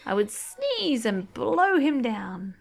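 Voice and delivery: gentle storybook read-aloud; reading to children. Acted. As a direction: read it like a bedtime picture book. Reading picture book aloud.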